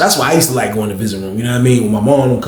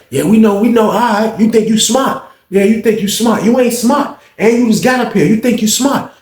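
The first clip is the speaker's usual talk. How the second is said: higher mocking woman's voice; mocking guard; 10 semitones higher than usual. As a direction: taunt him, you think you smart.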